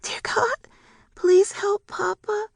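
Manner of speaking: very high, slow pleading prayer; child's prayer. A narrator's reading. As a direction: pray like a frightened little girl. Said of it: "began to pray".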